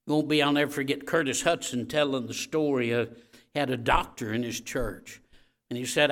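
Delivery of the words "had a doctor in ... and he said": ordinary delivery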